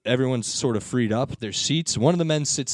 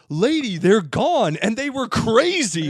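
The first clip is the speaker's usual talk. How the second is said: higher, slow, emphatic retold reply; mock exasperation; 8 semitones higher, 4 dB louder, slower than usual. Passengers to upset woman, mock exasperated. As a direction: spell it out slowly, exasperated.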